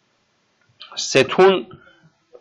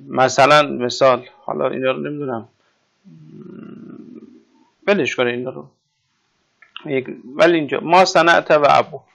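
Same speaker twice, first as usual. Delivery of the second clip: ordinary talk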